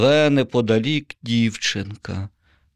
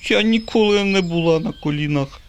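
put-on high, calm little girl voice; 10 semitones higher than usual. A narrator's reading. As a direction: answer coolly, like a fearless girl. Girl answers the zombie. (спокійний високий дівчачий голос)

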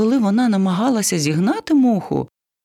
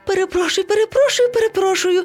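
very high, sheepish repeated apology; apologetic; 12 semitones higher, faster than usual. A narrator's reading. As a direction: apologise over and over, squeakily. Monkey apologises to everyone. (високе несміливе вибачення)

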